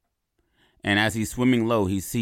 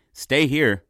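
raised, higher call to stay put; 7 semitones higher, 6 dB louder than usual. Retold or imitated. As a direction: call it out firmly, louder and higher. Man reassures octopus, calling out.

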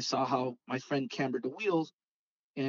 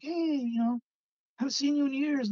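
high-pitched imitation of a reunited friend; retold happy greeting; 11 semitones higher than usual. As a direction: voice a pal glad to meet up.

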